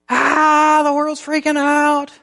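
high, loud, frantic mock panic; mock panicked person. Pastor mocking panicked toilet-paper hoarders, shrill squeal. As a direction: ham up a shrieking 2020 hoarder. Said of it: "just deflate fear a little bit".

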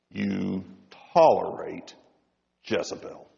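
low, slow, grave rebuke; a deep lament of judgment, softly; voice of many waters. Acted. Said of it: "in that voice, the voice of many, many waters".

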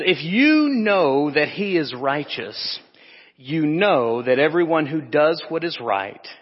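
slow, emphatic verse quotation; scripture reading. Acted. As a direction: stretch and stress the key words of the verse.

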